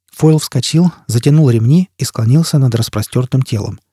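ordinary delivery, nothing marked

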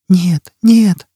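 put-on high child's protest; small voice pitched high; frightened child. A narrator's reading. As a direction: refuse as a scared little child. Child refuses Foyle's demand.

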